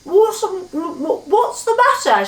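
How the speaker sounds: high, slow, muffled stammer; puzzled words round a full mouth. Acted. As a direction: talk through a mouthful of cake. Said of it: "she asked with her mouth full".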